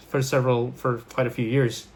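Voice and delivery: ordinary delivery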